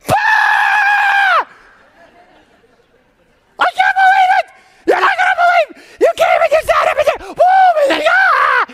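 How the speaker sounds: high, loud squealing delight; excited shrieks. Retold or imitated. Young women scream over a friend's pregnancy news, squealing with delight. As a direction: squeal like you just heard the best news. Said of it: "Mary and Elizabeth are like".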